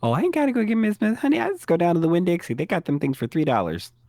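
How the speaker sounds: high, quick Southern sass; sassy Southern shopper. Acted. Co-host dismisses the pie brand for Winn-Dixie, high and sassy. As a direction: rattle it off as a thrifty Southern auntie.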